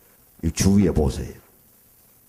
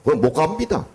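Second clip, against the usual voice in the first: blunt Gyeongsang refusal; 6 semitones higher than usual. Retold or imitated. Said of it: "경상도 사람은 화끈하지요".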